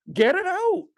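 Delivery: high, rising mock-panicked plea; mock urgent cry. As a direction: playfully cry out, jumping way up in pitch. Repeats mock reaction to snake.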